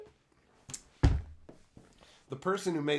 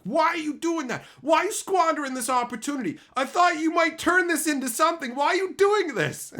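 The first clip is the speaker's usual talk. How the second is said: mock mom, much higher and louder, scolding; upset mom; 14 semitones higher, 7 dB louder than usual. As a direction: squeal it like a fed-up mother. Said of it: "she got really upset at me".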